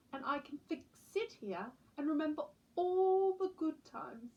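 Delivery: a gentle grandma savouring her memories; old lady voice. Acted.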